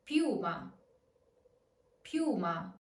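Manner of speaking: I in piuma glided into U; piuma with stressed U. Shown as a demonstration. Teacher modelling piuma for learners with stressed U. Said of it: "this U is strong is because it is stressed".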